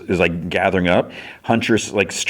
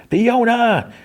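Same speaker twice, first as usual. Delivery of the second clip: distant call. Retold or imitated. Cake calls Fiona from a distance, mock distant call. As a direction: imitate someone calling from far away. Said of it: "then from a distance here".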